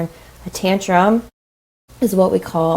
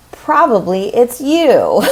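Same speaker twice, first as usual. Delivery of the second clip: slow, louder and higher, a knowing jab; teasing comeback; 5 semitones higher, 5 dB louder, slower than usual. Retold or imitated. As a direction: land it slowly as a cheeky punchline. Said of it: "And then I always say".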